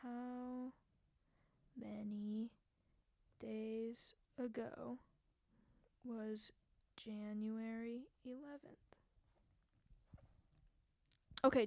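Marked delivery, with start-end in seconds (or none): 0.0-9.0 very slow and quiet, word by word; absent-minded, drawn-out reading to yourself with long gaps; typing aloud. Real, not acted. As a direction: murmur each word as you type it into a search.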